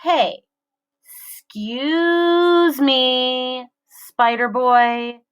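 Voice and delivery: slow, drawn-out sneering call; mock polite taunt. A narrator's reading. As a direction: draw it out, fake polite. Bully taunting across class.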